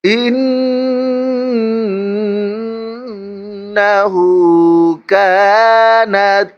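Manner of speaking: melodic, drawn-out tajweed chant; Quran recitation. Shown as a demonstration. Teacher recites for students, in melodic Quran recitation. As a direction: recite slowly with a lilting tune.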